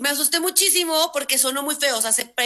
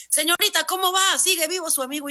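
high-pitched voice of a caller checking in; emergency operator; 6 semitones higher than usual. Retold or imitated. Emergency operator calling about the friend, high put-on voice.

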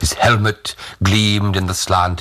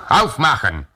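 higher-pitched German command; calling out; 7 semitones higher than usual. A narrator's reading. Soldier knocks at the window pane, called-out German order. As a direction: call an order through a window.

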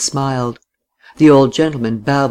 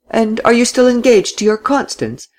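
high, lilting tease; arch, high-pitched question; touch of mockery; 9 semitones higher than usual. A narrator's reading. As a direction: needle them lightly with a raised voice. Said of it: "with a touch of mockery this time".

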